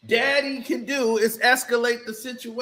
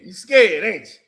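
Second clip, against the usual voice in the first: taunting; 7 semitones lower, 7 dB louder than usual. Acted. Taunting the scared player.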